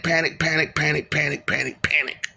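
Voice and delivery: ordinary talk, nothing marked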